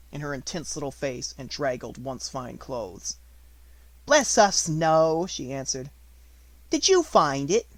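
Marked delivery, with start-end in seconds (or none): 4.0-5.3 a cheerful motherly baker; hearty baker woman. A narrator's reading. 6.7-7.7 hearty baker woman. A narrator's reading.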